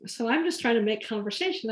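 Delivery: normal talking voice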